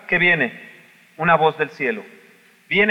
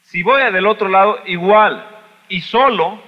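emphatic; 5 semitones higher, 7 dB louder, slower than usual. Acted. Louder preaching.

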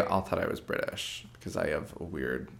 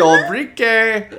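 much higher, loud, slow hammed-up Czech hello; mock Czech accent; 13 semitones higher, 11 dB louder, much slower than usual. Host joins in mocking the Czech greeting, loud and high. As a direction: stretch the greeting like a tourist mimic. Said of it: "a Eastern European, like, vague".

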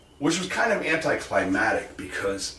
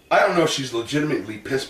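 normal talking voice

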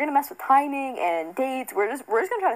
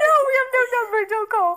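laughing; 12 semitones higher, faster than usual. Real, not acted. Laughing through a joke reply.

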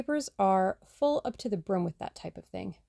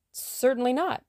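quick, higher, louder agreement; hasty; 6 semitones higher, 4 dB louder than usual. A narrator's reading. Tommy hastily reassuring Tuppence, a quick raised denial. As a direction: rush the denial out a touch raised. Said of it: "agreed Tommy hastily".

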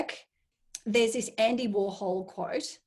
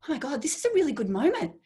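quick, high, thrilled aside; quick and bright with excitement; delighted inner thought; 6 semitones higher, faster than usual. Retold or imitated. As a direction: gush to yourself about the moment. Recalling her own thought.

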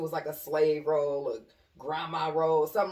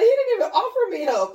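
high, loud, half-laughing indignation; mock outrage in a high voice; high indignant quote; 8 dB louder than usual. Friend's retold complaint.

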